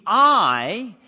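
"I" hit hard and loud; stress on I. Shown as a demonstration. Preacher stressing Jesus' emphatic "I" for the congregation. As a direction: punch the word "I" as emphatic. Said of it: "is in the emphatic in the original language".

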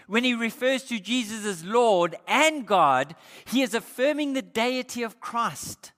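usual speaking voice

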